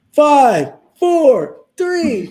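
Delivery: high, loud imitated countdown; countdown cheer. Friend counting down to New Year's at the bedside, cheering. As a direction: call each number out like a party countdown.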